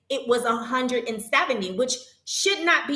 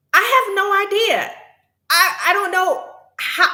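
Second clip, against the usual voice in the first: emphatic bafflement; 10 semitones higher, 7 dB louder than usual. Real, not acted. Emphatic answer to live viewer.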